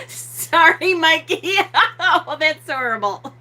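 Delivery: high, helpless laughter through the words; real laughing fit. Real, not acted. Streamer apologises to Mikey, laughing hard. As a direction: crack up while trying to apologise. Cracking up over dog puke remark.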